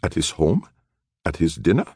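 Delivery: rising startled questions. Acted. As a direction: caught off guard, asking. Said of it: "The man looked startled".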